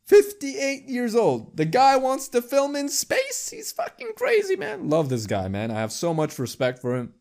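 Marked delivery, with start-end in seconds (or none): 0.1-4.9 high-pitched, astonished exclaiming; incredulous amazement. Real, not acted. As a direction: marvel at it, voice shooting way up. Amazed at actor's age and plan.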